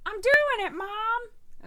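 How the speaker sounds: put-on high whiny child voice; whiny kid. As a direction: whine it like a kid to mom. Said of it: "you can be like".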